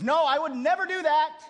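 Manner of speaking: high, loud denial; Peter protesting. Retold or imitated. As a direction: protest hard, like a bold disciple. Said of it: "so Peter says".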